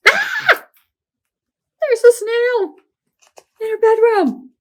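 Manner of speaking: very high, loud startled scream; shocked scream. A narrator's reading. Storyteller shrieking as the snail appears, startled.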